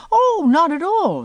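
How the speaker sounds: high, loud, over-bright denial; flustered man putting on a smile; too-eager polite reassurance; forced politeness. A narrator's reading. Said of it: "trying to smile".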